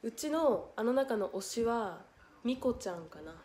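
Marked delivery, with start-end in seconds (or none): none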